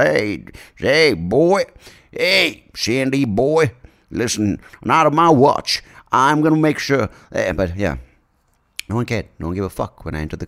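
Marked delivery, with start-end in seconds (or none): 0.1-7.1 high, loud, slowed hectoring; mock threatening politician. Comedian imagines a president warning him, loud mock threat. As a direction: hail and warn in a hammed-up official's voice. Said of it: "He never said".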